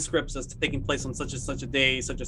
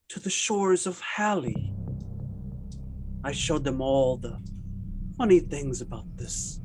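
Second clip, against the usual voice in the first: slow, dreamy, softer unsettling suspect; creepy suspect; 4 dB quieter, slower than usual. Acted. Suspect taunting the investigator in a slow, dreamy voice. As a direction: drift through it like a madman toying with you.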